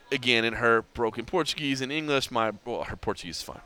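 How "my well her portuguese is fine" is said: ordinary talk, nothing marked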